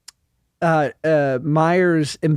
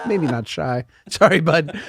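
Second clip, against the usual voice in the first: ordinary delivery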